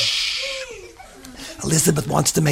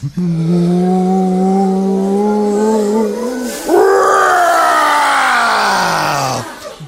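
roaring fire breath; 3 semitones higher than usual. Acted. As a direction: roar out a huge blast of fire.

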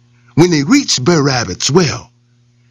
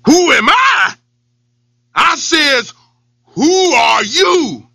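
roared; 9 semitones higher, slower than usual. Acted. Angry lion roars down the well, furious. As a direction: roar it down in anger. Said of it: "roared back down".

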